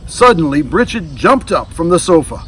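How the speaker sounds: usual speaking voice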